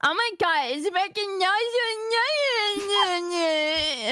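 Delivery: put-on high whiny valley girl. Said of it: "Valley Girl voice".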